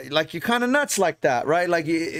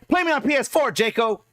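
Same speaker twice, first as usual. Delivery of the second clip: mock Goggins challenge, pitched up; 7 semitones higher than usual. Streamer mimics Goggins challenging him, pitched-up mock voice. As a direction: imitate Goggins calling him out, higher. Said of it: "That's my best Goggins impression".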